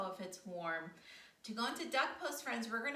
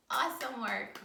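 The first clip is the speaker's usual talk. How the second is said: ordinary talk, nothing marked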